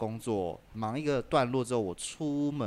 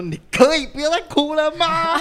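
higher, louder mock yell, laughing; retold yell; 12 dB louder than usual. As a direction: yell it at the phone, half laughing. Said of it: "我就是在街上大吼".

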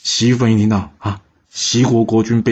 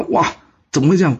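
shocked; 8 semitones higher than usual. A narrator's reading. King shocked by the self-maiming.

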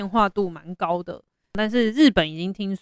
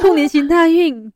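higher, louder echoed new year wish; cheerful new year wishes; 8 semitones higher, 7 dB louder than usual. Real, not acted. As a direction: toss the wish back brightly. Echoing new year greeting.

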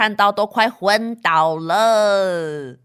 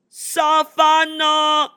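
louder, slow, alarmed question; alarmed shout; 6 semitones higher, 5 dB louder, much slower than usual. Acted. Nanny demanding the sofa from Kailuo, louder and slow. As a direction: call out to the child in dismay.

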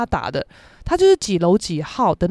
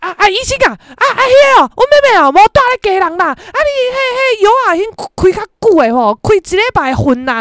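much higher and louder, an imitated loud mother; loud mom; 9 semitones higher, 7 dB louder than usual. Mom interrupting the doctor, imitated loud and high. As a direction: barge in loudly like a chatty mom. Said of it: "我妈讲话很大声".

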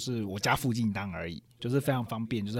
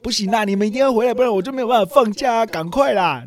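put-on much higher and louder, childish demand; whiny insistence; 10 semitones higher, 10 dB louder than usual. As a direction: throw a grown man's tantrum at his parents. Son insists parents come back. (在电话里对爸妈撒娇耍赖，又高又大声)

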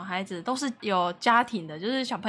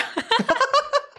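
burst of laughter; 14 semitones higher than usual. Real, not acted. 笑谈孩子说夜到了. (高声爆笑)

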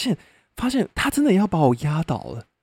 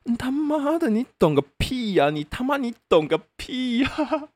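indignant retort; 11 semitones higher than usual. Retold or imitated. Retorting to woman calling him weak.

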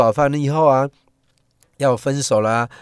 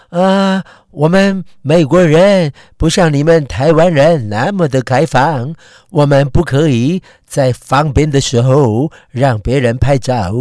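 put-on loud foreign-accented Mandarin; an American woman speaking careful Mandarin; foreigner speaking mandarin; 2 semitones higher, 7 dB louder than usual. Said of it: "用她的洋腔洋调说".

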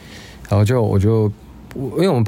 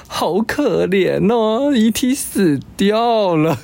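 much higher, slow, pitiful whimper; hammed-up crying voice, drawn out; mock teary whine; 10 semitones higher, much slower than usual. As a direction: imitate a coworker sobbing over a film. Voicing crying coworker.

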